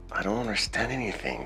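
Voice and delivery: fast, hushed aside; quiet bewildered aside. Retold or imitated. Confused pupil reacting to an English greeting, quiet aside.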